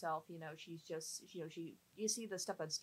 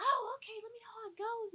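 high breathy whisper of a mom, much higher; imitating mom; 4 dB louder than usual. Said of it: "And she's like".

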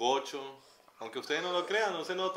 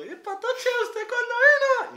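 much higher, louder, slow Andean gocho greeting; exaggerated gocho accent; 12 dB louder, much slower than usual. Greeting a customer from home, in a hammed-up gocho accent. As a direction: greet her like a Táchira local, high and drawn out. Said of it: "Y yo".

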